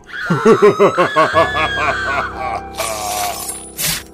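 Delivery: long maniacal horror laugh; evil laugh. Acted. Announcer after welcoming listeners, evil cackle.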